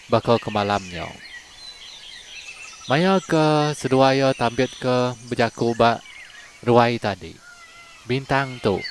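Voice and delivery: usual speaking voice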